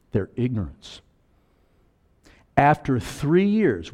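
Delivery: ordinary talk, nothing marked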